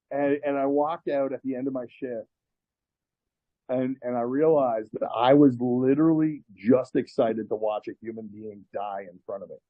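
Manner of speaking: ordinary delivery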